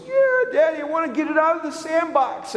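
put-on high, pleading little boy. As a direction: sound like a four-year-old asking his dad. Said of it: "And he said".

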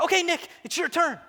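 high, bright-spirited retold call; eager summons. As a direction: call him up cheerfully, pitched high. Pastor summons him to the rod.

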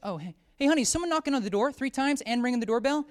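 a hint of a cheerful couple at home; mock homeowners. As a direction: play the eager homeowners for a laugh. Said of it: "they were probably, like".

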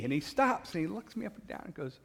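normal talking voice

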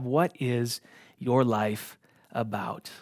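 slowed right down, every word weighed; preacher pressing the listener to answer; slow pointed question. Shown as a demonstration. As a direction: press the question home slowly. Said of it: "put you in a stressful moment".

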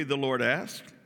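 ordinary talk

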